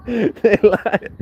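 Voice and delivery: laughing it out high and loud; cracking up as he says it. Real, not acted. Telling a friend the buyers lied, laughing hard. Laughing while recounting the lie.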